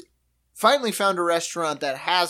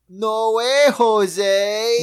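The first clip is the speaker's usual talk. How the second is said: cheesy catchphrase; 4 semitones higher, 6 dB louder, much slower than usual. Retold or imitated. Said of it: "And he goes".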